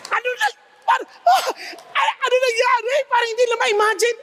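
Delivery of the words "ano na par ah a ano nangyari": high, broken, sobbing question; crying in shock. Retold or imitated. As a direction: cry out at a friend's ruined body. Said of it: "Iyak sila".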